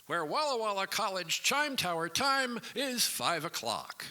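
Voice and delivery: loud, high-pitched station ID; yelling to be heard on air; shouted station announcement. Acted. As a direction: shout the time over clanging chimes. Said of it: "louder than my voice, and I said".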